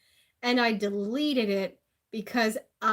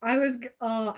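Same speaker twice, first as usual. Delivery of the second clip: ordinary talk, nothing marked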